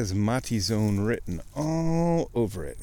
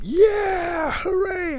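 cheering; much slower than usual. Retold or imitated. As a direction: let out a big celebratory cheer. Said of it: "I started cheering".

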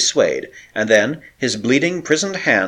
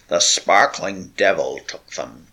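slow, low croak; 4 semitones lower, slower than usual. A narrator's reading. Broken sailor telling of his lost family, croaking. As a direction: rasp it out of a parched throat. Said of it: "croaked Olaf Huldriksen".

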